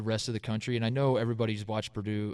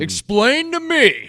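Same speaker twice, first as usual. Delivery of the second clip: slow, loud, high mock outrage; fans losing their minds; 11 semitones higher, 9 dB louder, much slower than usual. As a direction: drag out the indignant demand. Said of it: "Purdue fans losing their minds".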